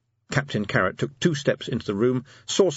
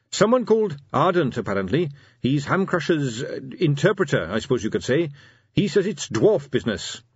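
put-on earnest young officer reporting, voice pitched up; earnest captain Carrot; 6 semitones higher than usual. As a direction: report dutifully to your commander.

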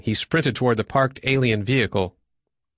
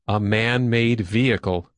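ordinary delivery

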